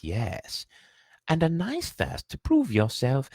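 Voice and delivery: put-on high, pondering old hat voice; musing Sorting Hat. The Sorting Hat sizing up Harry, musing aloud. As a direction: weigh him up aloud, unhurried.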